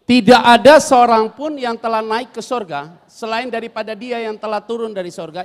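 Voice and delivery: loud, measured reading aloud; scripture read in unison; loud group Bible reading. Acted. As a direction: lead the church reading the verse. Reads scripture aloud with congregation.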